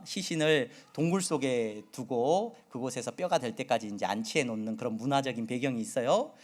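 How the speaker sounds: normal talking voice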